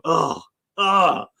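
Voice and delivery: ordinary talk, nothing marked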